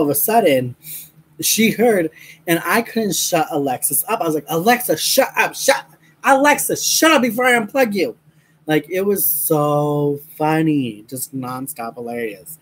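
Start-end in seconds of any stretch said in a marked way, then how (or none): 4.5-8.1 high-pitched mock scolding of Alexa; retold exasperated threat. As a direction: act out losing patience, pitched up.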